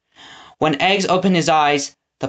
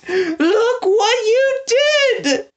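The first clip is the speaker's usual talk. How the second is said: much higher, slow anguished wail; wailing accusation; much slower than usual. A narrator's reading. Prisoner repeats the blame, wailing higher. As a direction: let it break into a wail. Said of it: "said the prisoner".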